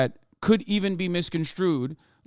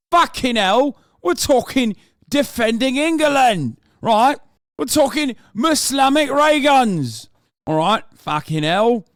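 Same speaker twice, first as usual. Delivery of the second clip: loud, higher mock British lad shouting; mock English lad; 7 semitones higher, 5 dB louder than usual. Streamer parodying English Defence League supporters in a loud mock British lad voice. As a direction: ham up a rowdy English nationalist.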